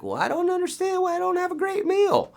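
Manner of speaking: high, mocking whiny voice; mock the student who never cooks; lazy student voice. Teacher mocking a lazy student, high whiny voice. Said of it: "and you're like".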